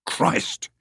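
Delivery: ordinary delivery, nothing marked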